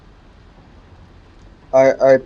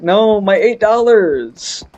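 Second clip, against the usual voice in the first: mock dismay; 6 semitones higher, much slower than usual. Mock losing her money.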